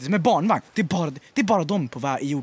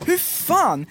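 exasperated retold outburst, raised pitch; irriterat utrop; 8 semitones higher than usual. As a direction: act out mild irritation at being crowded. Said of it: "jag är så irriterad". (irriterat, återberättat utbrott)

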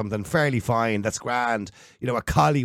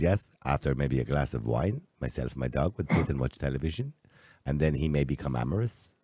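imitated German accent, lower and softer; 6 semitones lower, 5 dB quieter than usual. Host retelling an interviewee's words in a German accent. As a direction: put on a low-key German accent. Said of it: "I hate to do the German accent".

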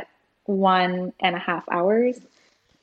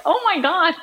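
higher, laughing disbelief; mock shock; 8 semitones higher than usual. Mother overhearing the class, mock shock. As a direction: imitate your own aghast reaction through laughter. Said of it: "And I'm like".

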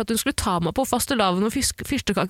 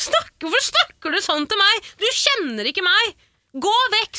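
mock indignant retort; 9 semitones higher than usual. Storyteller replaying how she told the customer off, raised voice. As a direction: push her away, voice raised. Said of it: "jeg var mye mer shaken i stemmen".

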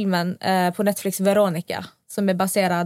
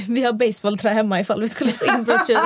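amused, laughing through the explanation. Real, not acted. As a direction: let a laugh break into the aside. Laughs while explaining the baseball bat.

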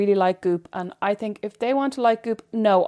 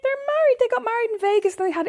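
excited; 13 semitones higher than usual. Real, not acted. Confirming the news excitedly.

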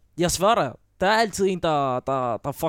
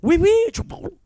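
much higher, bright mock French "oui, oui"; hammed-up fake French; 13 semitones higher, faster than usual. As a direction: oversell the bluff. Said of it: "lader som om, jeg snakker fransk".

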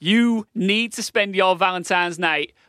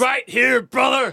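hype shout; 4 semitones higher, slower than usual. Acted. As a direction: pump up the audience like a wrestling promo. Hyping the live show.

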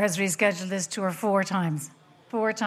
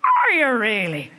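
surprised dad; 5 dB louder, slower than usual. Retold or imitated. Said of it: "And he said".

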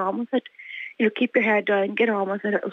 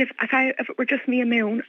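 ordinary talk

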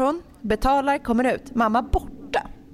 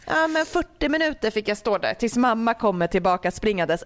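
ordinary talk

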